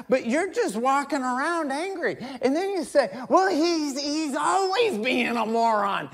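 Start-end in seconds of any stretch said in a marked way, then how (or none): 3.3-6.1 high, loud acted grievance; mock indignant excuse. Angry man defends himself to preacher, mock indignant. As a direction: protest in a hammed-up raised voice.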